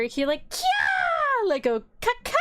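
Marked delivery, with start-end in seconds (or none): 0.5-1.5 very high, piercing crow screech; vicious crow caw. Retold or imitated. Crow looming behind the accused woman, vicious screech. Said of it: "there's a vicious crow behind her". 2.0-2.3 high two-note imitated crow cry; vicious crow caw. Crow about to eat you, vicious caw. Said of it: "like, very viciously".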